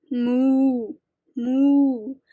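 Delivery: long drawn-out moos; cow. A narrator's reading. Teacher reading animal talk to children, as a cow. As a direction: moo like a cow. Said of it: "said the cow".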